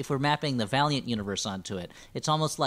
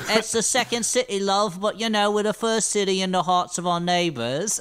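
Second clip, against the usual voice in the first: put-on higher, louder Chicago accent with a British twist; hammed-up Chicago local with a British "love"; 4 semitones higher, 4 dB louder than usual. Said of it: "that famous Chicago accent".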